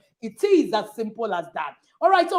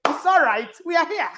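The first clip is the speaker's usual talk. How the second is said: mock shouted complaint; 5 semitones higher than usual. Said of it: "people went".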